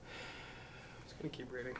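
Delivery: breathy under-the-breath aside to self; soft murmured note before reading on; whispered aside. Real, not acted. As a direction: drop to a near-whisper and mutter it to yourself. Muttered aside before resuming reading.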